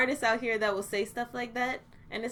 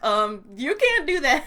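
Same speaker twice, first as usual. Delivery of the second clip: mock trademark letter; 2 semitones higher, 8 dB louder than usual.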